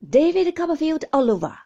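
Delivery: scornful cry. Acted. Aunt Betty scoffs at the dead husband, loud and fast. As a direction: fling it out in disgust. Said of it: "cried Miss Betty".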